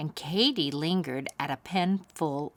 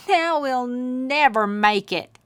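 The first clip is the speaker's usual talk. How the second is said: wailing; 6 semitones higher, 5 dB louder, slower than usual. Acted. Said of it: "he wailed".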